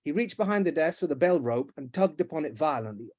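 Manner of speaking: plain reading voice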